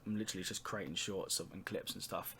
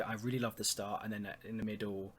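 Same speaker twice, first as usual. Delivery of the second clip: usual speaking voice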